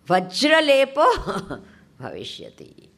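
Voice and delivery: laughing through the words. Real, not acted. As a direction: giggle out the verse.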